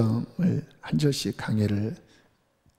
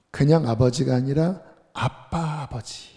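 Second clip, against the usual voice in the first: ordinary talk, nothing marked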